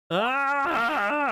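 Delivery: sudden high yelp, quickly cut off; a short startled cry he won't commit to; mock scream, held back; half-hearted scream. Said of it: "I'm not screaming alone in my room".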